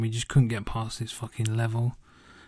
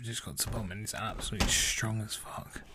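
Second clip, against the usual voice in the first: whisper; 7 dB quieter than usual. Real, not acted. Aside about strong tea.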